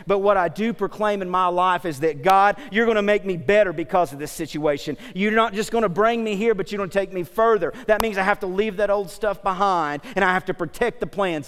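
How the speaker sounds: loud, emphatic declaration of faith; proclaiming preaching. Acted. Preacher proclaiming that God will take them further, emphatic preaching. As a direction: proclaim it with full conviction.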